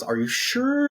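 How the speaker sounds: high-pitched, rising check; checking question. Acted. Amy checks Chris's offer, high rising question. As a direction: ask it high, lifting the last word.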